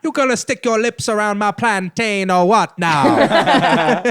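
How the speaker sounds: loud, high, slow put-on Jamaican accent. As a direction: heckle in a hammy Jamaican voice. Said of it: "you're negging another Jamaican".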